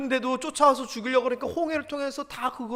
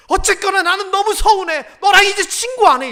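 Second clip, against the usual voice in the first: upset friend's complaint; 8 semitones higher, 11 dB louder than usual. Retold or imitated. Friend sulking, quoted in story.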